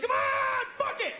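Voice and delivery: high loud urgent shout; demanding yell. Retold or imitated. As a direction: bark it at him, impatient. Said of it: "She's like".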